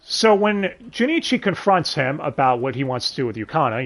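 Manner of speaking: ordinary talk, nothing marked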